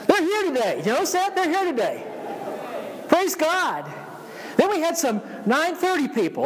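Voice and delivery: high, excited preacher. Real, not acted. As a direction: cheer them on with a lift. Pastor excitedly greets returning members.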